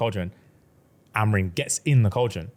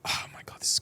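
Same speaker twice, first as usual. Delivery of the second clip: breathy unvoiced aside; whisper; 9 dB quieter, faster than usual. Real, not acted. Storyteller awed by the plot, whispered aside. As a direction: whisper it in awe. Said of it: "whispered aside".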